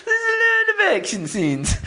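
high squeaky voice, half crying with laughter; laugh-crying silly voice; weird high voice. Retold or imitated. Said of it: "I don't know what that was".

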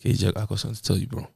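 low, soft voice for a heavy confession; hushed, low put-on solemn friend; grave confiding voice. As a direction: drop it deep and quiet, like big news coming. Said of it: "It's the voice as well".

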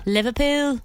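first try at a Scouse accent; Liverpool accent. Shown as a demonstration. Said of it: "Can you do a Liverpool accent?".

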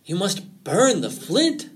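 high, slow, emphatic command; urgent fox. A narrator's reading. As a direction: stretch out the urgent order. Fox urges Rabbit to burn it.

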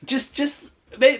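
high, slow faltering voice; hesitant pilot. Acted. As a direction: stumble over the words, unsure. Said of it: "announcement over the speakers".

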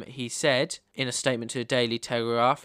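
ordinary delivery

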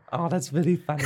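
usual speaking voice

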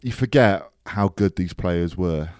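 usual speaking voice

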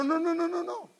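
high, rapid string of no; emphatic denial. Real, not acted. Preacher rejecting a wrong idea of faith, high and insistent.

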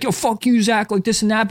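imitating an upset kid. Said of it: "like, got upset".

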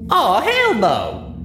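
high, slow, loud tipsy whoop; drunken brag. A narrator's reading. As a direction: crow it out proudly, half drunk. Drunk Rainbow Dash boasts.

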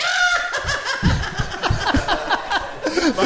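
high shrill cackling laugh; Salacious Crumb cackle. Retold or imitated. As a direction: cackle like a little creature. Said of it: "the character that you did the voice for".

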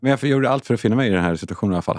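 ordinary talk

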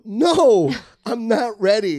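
high, loud, drawn-out refusal; mock panic. Comic answering stage manager in the wings, mock panic. Retold dread before going on.

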